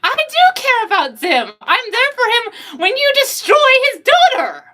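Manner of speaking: high and smug; high, snooty character taunt; haughty girl voice. Acted. Julie arguing back.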